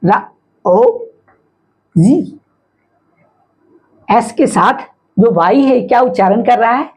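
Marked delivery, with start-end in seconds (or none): 0.0-2.4 रा, ओ, जी with long pauses; sounding out. Shown as a demonstration. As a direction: read it piece by piece: रा, ओ, जी. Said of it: "इसको क्या पढ़ें".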